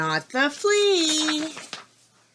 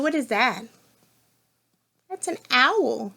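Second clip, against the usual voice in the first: ordinary delivery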